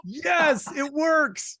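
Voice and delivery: mock triumphant shout. Host jokingly cheers a three-dollar check, high mock shout. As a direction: shout it like the scam paid off.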